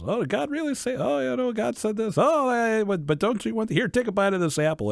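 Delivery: serpent voice. Retold or imitated. Serpent tempting Eve with the apple, high sly voice. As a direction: tempt her like a sly snake. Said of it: "the devil appears to her as a serpent and says".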